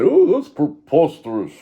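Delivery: high, loud, drawn-out scoff; hammed-up indignant denial, slow and high; mock outrage. Deceptive player denying it all, high mock outrage.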